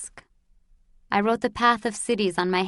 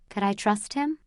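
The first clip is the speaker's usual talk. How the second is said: usual speaking voice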